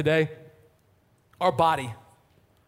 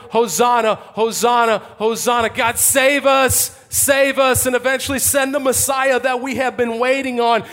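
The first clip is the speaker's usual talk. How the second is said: raised, insistent shout of praise and plea; shouting crowd; 4 semitones higher, 5 dB louder than usual. Acted. Festival crowd at the altar crying Hosanna, shouting. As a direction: call out loudly to God, stretching the cries. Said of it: "and they're shouting".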